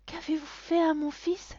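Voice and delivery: high, loud horrified cry; alarmed mother. A narrator's reading. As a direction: gasp out your alarm as a mother. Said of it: "s'effare la mère". (mère effarée, cri aigu)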